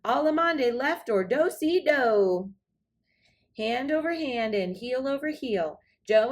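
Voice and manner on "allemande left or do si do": high, loud call to a cowboy; spirited dance call. Acted. Cindy calls out to Joe on the dance floor, loud and teasing. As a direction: tease him to speed up, calling out. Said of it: "And Cindy answered".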